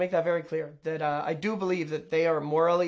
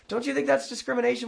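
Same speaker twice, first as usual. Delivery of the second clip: quick, high, heated question; 6 semitones higher, faster than usual. Retold or imitated. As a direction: fire it back fast and hot. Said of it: "I was like".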